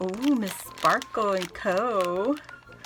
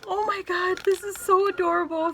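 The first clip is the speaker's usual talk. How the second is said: excited gushing; 9 semitones higher, faster than usual. Real, not acted.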